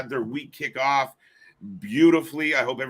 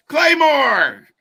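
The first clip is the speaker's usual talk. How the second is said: announcer shout; 13 semitones higher, 10 dB louder than usual. Acted. Mimics announcer call.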